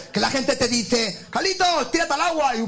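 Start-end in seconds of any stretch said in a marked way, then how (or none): 1.3-2.6 high-pitched call to a friend. Acted. People urging Carlitos into the water, calling out.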